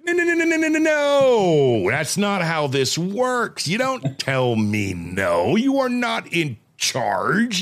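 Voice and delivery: high, slow, drawn-out mock scolding; retold scolding. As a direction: stretch each no like an outraged dad. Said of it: "the anger came in".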